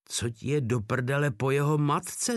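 usual speaking voice

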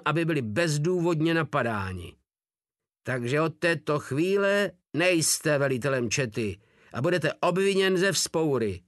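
ordinary delivery

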